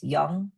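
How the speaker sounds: put-on "young" said as Korean nyung or yung; syllable demonstration. Shown as a demonstration. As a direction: show "young" as the second syllable of annyeong. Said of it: "The second syllable is".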